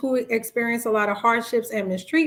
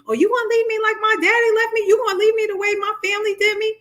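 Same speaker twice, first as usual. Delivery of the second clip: hammed-up guilt trip; 10 semitones higher, faster than usual. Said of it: "they will make you feel guilty".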